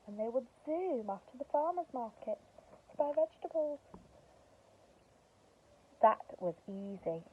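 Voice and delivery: expressive storybook narration; reading to children. Acted. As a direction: tell it like a bedtime story.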